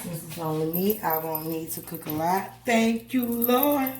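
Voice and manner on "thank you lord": high thankful exclamation; high praise. Real, not acted. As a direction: lift it up to the Lord. Praises God.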